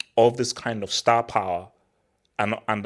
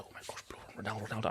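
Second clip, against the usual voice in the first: under-the-breath mock awe, much softer; retold hushed excitement; 17 dB quieter, much faster than usual. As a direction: gasp it out barely voiced, as a retold memory. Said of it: "I was, like, excited".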